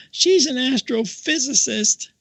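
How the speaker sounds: very high, slow imitated outburst; retold nun's big reveal. Nun gives away her companion's job, high imitated outburst. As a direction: burst out with the secret, pitched high.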